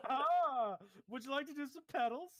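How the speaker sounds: high, loud clown sing-song; hammed-up clown offering; giddy clown offer. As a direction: tempt him like a goofy clown.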